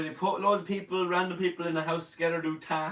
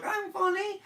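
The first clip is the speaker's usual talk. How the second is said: much higher. Retold or imitated. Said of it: "I was like".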